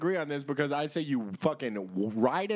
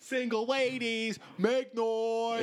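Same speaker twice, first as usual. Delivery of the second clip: club DJ hype call; 7 semitones higher, much slower than usual. Pretend.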